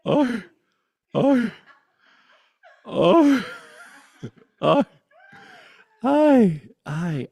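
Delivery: high, loud playful oi calls with laughs; silly calls. Pretend. Teacher being silly between points, high playful calls. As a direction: yelp like a goofy cheer.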